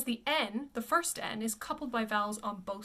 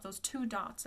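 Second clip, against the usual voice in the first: usual speaking voice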